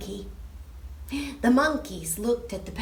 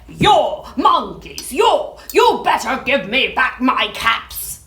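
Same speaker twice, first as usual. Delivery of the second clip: loud, angry warning; quite angry; 9 dB louder than usual. Acted. Peddler stamps his foot at the monkeys, loud and angry. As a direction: warn them, properly cross. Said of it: "Now he felt quite angry".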